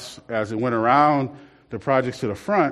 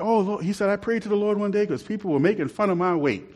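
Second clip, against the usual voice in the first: imitating comedian; 6 semitones higher, 3 dB quieter than usual.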